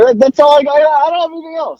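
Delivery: high, rushed and flustered. Real, not acted. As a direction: blurt it out fast, flustered. Flustered wrap-up.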